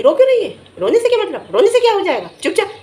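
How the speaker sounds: high-pitched mock scolding; dismissive telling-off, pitched well up; imitated scolding matriarch. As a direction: mimic a mother-in-law telling off someone crying. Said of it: "किस तरीके से ये उस लड़की को बार बार बोल रही थी".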